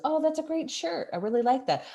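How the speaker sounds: high-pitched, gushing compliment; admiring complimenter. Acted. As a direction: pay an eager compliment to a friend. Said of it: "somebody says".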